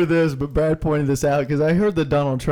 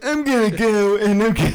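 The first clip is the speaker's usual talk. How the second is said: higher, exaggerated Southern accent; 6 semitones higher than usual. A guy mocking Trump, trying a Southern accent. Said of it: "is that how we do our Southern accent?".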